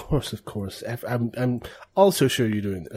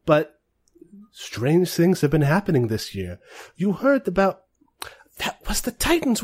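higher, slightly wary in-character voice; lightly voiced noblewoman; 7 semitones higher, slower than usual. Woman sharing rumours of strange statues, lightly voiced noblewoman. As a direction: confide strange news in a raised voice. Game master plays noblewoman.

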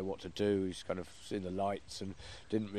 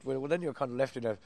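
ordinary delivery

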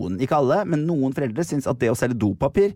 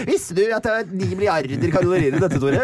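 high mock scolding voice; mother teasing about sushi calories in a high mock voice; imitating mother; 7 semitones higher than usual. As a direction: tease him like a nagging mother. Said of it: "et hint".

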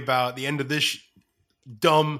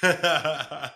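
laughing; 5 semitones higher, 5 dB louder than usual. Real, not acted. Laughing through his plug.

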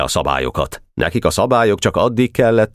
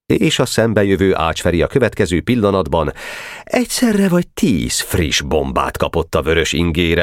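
ordinary delivery

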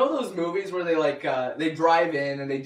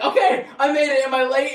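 higher, louder, laughing; relieved excitement; 8 semitones higher, 7 dB louder than usual. Retold or imitated. Arriving at the pool gate, asking the leader, excited.

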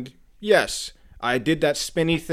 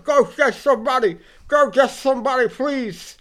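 higher and louder, desperate begging; retold pained plea, voice pitched high; panicked plea; 12 semitones higher, 9 dB louder than usual. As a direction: beg for help in a high, frantic voice. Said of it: "I'm like".

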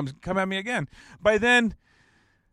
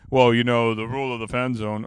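lower, put-on voice imitating the kid; low-voiced kid impression; 3 semitones lower, 3 dB louder than usual. Kid quoting the Fan Zone rule, mimicked in a low voice. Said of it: "he probably didn't sound like that".

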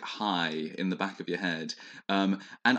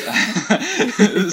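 loud, high-pitched giggle; high embarrassed laugh; 13 semitones higher, 11 dB louder than usual. Real, not acted.

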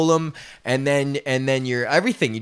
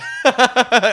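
high sudden laugh; 9 semitones higher, 4 dB louder than usual. Real, not acted. Laughs mid story.

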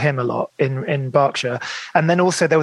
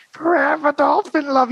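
much higher, slow eerie crooning; creepy voice; 12 semitones higher than usual. Retold or imitated. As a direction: croon it like a haunting chorus. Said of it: "it was this really sort of almost creepy voice".

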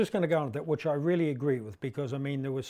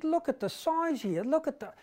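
amazed reporter; 11 semitones higher than usual. Retold or imitated. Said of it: "And she said".

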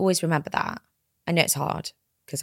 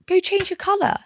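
excited; 9 semitones higher than usual. Real, not acted. Excited hair encouragement.

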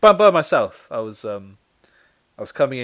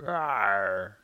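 lion cub; 6 semitones lower, 4 dB quieter than usual. Acted. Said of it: "Little roar".

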